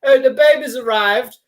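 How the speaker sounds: mock posh chat, lifted in pitch; posh parent. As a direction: play a well-to-do couple naming the baby. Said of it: "just posh people do".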